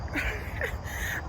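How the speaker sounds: short airy giggle, worn out; breathless giggle. Real, not acted. Winded giggle at herself to viewers. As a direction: giggle from exhaustion.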